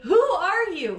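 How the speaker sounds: high, loud mock demand; mock exasperation. Podcaster calling out mystery listeners, mock exasperation. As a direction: demand it in playful disbelief.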